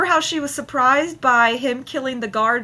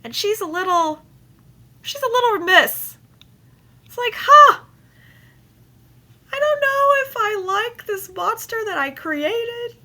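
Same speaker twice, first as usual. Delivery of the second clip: high squeaky mock of an uneasy wife; mock Lady Macbeth; 13 semitones higher, 5 dB louder than usual. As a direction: raise the voice way up, fretful and fast. Paraphrasing Lady Macbeth mockingly.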